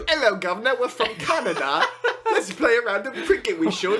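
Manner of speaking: high-pitched hammed-up British caricature; jokey British accent with laughter creeping in; mock British accent. Mock British cricket players.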